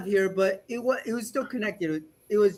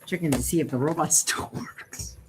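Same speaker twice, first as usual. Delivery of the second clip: whispering; 7 semitones lower, 6 dB quieter than usual. Real, not acted. Answers quietly while testing robot.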